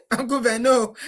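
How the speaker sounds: ordinary delivery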